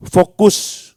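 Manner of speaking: sudden loud emphatic call; emphatic shout. Real, not acted. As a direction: punch it out loud. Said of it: "urging elders to focus". (seruan keras penuh tekanan)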